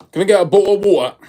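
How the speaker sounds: hammed-up London accent ordering water; mock British accent. Shown as a demonstration. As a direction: exaggerate the glottal "bo'le of wa'er". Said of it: "Is the accent a bit different?".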